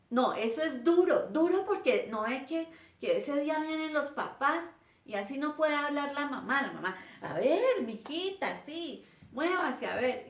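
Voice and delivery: exasperated teacher nagging a pupil; scolding teacher. Retold or imitated. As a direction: scold the child for her weak voice. Teacher scolding the shy girl.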